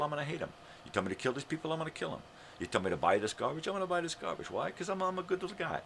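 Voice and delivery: high, sarcastic voice of a dutiful good citizen; lightly voiced naive follower, pitch up high; mock obedient patriot. Mocking an obedient "good American.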